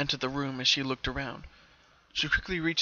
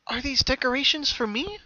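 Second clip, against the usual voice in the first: higher, hopeful pony voice; lightly raised Pinkie questioning; Pinkie voiced; 10 semitones higher, 4 dB louder than usual. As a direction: ask it as young bright Pinkie. Pinkie asks in dark shop.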